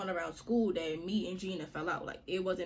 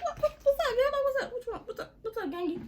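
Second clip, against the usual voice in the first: much higher, louder, squeaky fake-innocent "what's up"; mock surprised; 3 dB louder, faster than usual. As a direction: play dumb in a high startled voice, laughing. Retelling her own surprised reply.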